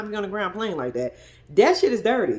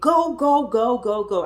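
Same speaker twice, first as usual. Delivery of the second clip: retold yelling; 3 semitones higher, 4 dB louder, slower than usual. Man in the car urging the driver on, mock yelling. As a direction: imitate a yell that quickly eases off. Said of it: "yelling at Monique".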